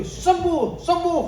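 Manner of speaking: high, ringing mock prayer; mock healing command. Preacher mimicking a student praying for healing, high and fervent. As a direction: belt it like an overeager faith healer.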